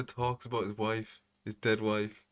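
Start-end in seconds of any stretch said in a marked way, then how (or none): none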